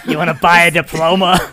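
loud hammed-up dealer pitch, laughing; laughing mock dealer. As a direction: sell it like a shady dealer, cracking up.

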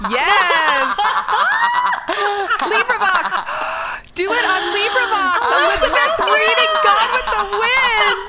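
high, loud, laughing cheer; excited. Real, not acted. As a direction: shout it out through delighted laughter. Excited reaction to reading offer.

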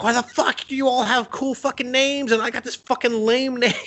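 very high-pitched mock tantrum; little fit. Little goddess jealous of her family's cool names, throwing a mock fit. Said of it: "having this little fit at times".

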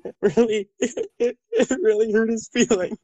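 high-pitched, broken-up crying. Acted. Wounded man saying his feelings were hurt, crying. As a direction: choke out the words between sobs. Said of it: "oh, no, don't cry".

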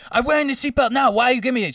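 high, loud imitated African accent; indignant African driver, put on. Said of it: "I'm gonna do the accent".

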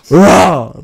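loud mock monster roar. Friend imitating the lady in bed, loud mock roar.

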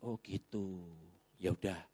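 low, soft retold concession; subdued acceptance. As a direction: give in quietly as the persuaded friend. Friend gives in, retold. (menirukan teman pasrah, pelan dan rendah)

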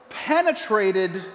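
normal talking voice

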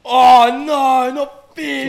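loud. Real, not acted. Hearing it tastes like fish.

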